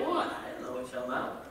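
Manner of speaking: confused and amused; baffled reply. Retold or imitated.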